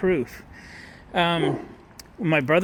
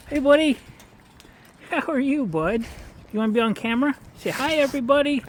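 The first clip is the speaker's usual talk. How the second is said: much higher, louder sing-song voice for the dog; pet talk; 12 semitones higher, 5 dB louder than usual. Real, not acted. Talking sweetly to neighbor's dog.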